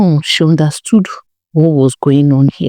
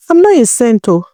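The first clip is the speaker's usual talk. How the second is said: sassy retort; 7 semitones higher than usual. A narrator's reading. Chisom retorts to Amaka.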